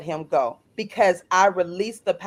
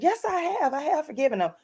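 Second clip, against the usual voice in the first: indignantly insisting, pitched up; defensive protest; 7 semitones higher, 5 dB quieter, faster than usual. Retold or imitated. Woman answers her pastor's challenge about forgiveness, defensive protest.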